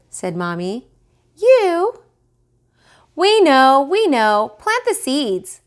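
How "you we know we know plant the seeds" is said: put-on high, loud impatient little kids; twins' voice. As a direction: brush it off like know-it-all twins. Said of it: "cried the twins".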